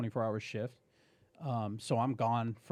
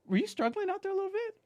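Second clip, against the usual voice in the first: much higher mock-innocent wife voice; wife's voice. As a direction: ask it sweetly, up high like his wife. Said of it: "she goes".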